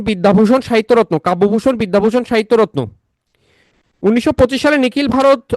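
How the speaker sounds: high-pitched, repetitive fact drilling; emphatic lecture. Real, not acted. (উঁচু সুরে মুখস্থ করানো)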